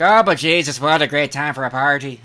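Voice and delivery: loud, cheery put-on Irish lilt; hammed-up Irish party voice; mock Irish reveler. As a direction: play a drunk Irishman greeting you. Mimicking drunk Irish reveler.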